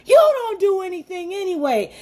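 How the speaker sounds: high put-on voice, mocking the angry man; mock yell. Coworker insulting her at work, high mock yell. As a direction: caricature a ranting coworker. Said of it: "he was yelling".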